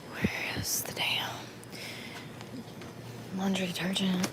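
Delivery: muttering while hunting the shelf; annoyed whisper. Real, not acted. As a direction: whisper in frustration. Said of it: "searching, whispering".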